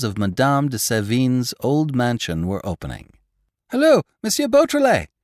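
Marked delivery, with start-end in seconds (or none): 3.7-5.1 high, loud call of welcome; loud greeting. A narrator's reading. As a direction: hail him across the hall. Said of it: "A dozen voices greeted his arrival".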